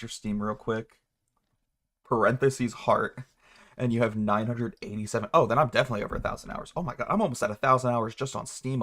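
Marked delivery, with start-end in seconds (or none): none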